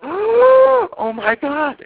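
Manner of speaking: mock-horrified overreaction; mock shocked gasp. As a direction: pretend to be scandalised.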